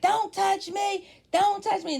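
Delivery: mock scream. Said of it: "she started screaming".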